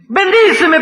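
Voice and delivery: "bendíceme" cried out high and loud; "bendíceme" shouted up high in desperation; sharp, high "bendíceme"; high cry. Acted. Second bendíceme.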